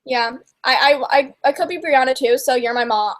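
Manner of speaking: ordinary delivery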